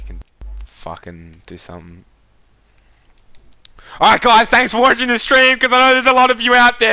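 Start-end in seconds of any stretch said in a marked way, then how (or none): 4.0-6.9 loud, high sign-off to viewers; loud stream outro. Real, not acted. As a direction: belt out the goodbye to chat.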